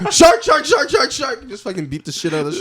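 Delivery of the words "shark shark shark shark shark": high, loud, rapid-fire yelling; 像发现猎物一样激动地连声大喊; frantic seal. Acted. Acting out seal spotting a shark.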